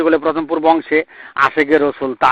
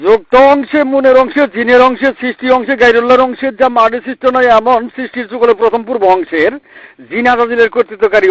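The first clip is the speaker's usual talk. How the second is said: preaching at full force; 7 semitones higher than usual. Acted. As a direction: lift it into a ringing sermon chant. Preacher raises chant to crowd.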